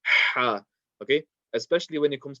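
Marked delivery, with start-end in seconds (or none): 0.1-0.6 "ha" forced from mid-throat with a strong breath; powerful ha. Shown as a demonstration. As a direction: put real power into the breath of "ha". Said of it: "power into that ha".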